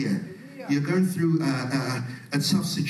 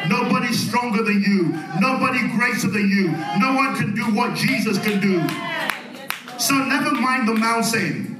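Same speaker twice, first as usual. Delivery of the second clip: raised, higher-pitched rallying sermon voice; full-force preaching; 7 semitones higher, 3 dB louder than usual. Real, not acted. Preacher urging congregation to look past the mountain, full-force preaching. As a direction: preach it with rising conviction and emphasis.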